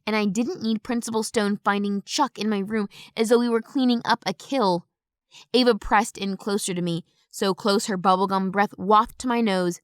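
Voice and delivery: usual speaking voice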